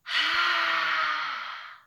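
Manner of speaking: long breathy dragon exhale; dragon breath sigh. Shown as a demonstration. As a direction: blow out like a dragon. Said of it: "make a dragon sound like this".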